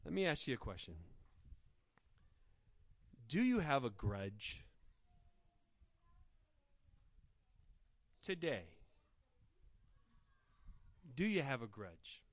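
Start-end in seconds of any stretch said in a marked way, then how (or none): 3.3-12.2 quiet sincere appeal. Real, not acted. Preacher gently asking his church about grudges, quiet. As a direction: ask gently and let it land.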